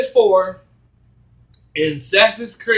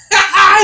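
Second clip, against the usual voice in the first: high burst of laughter; loud laugh; 11 semitones higher, 8 dB louder than usual. Real, not acted. Laughing at story.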